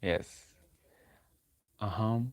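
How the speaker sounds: ordinary delivery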